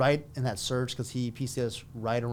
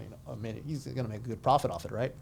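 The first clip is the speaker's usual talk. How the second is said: ordinary talk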